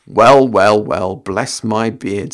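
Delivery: put-on low, slow old wizard musing; Gandalf voice. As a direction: savour it like an amused old wizard. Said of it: "said Gandalf".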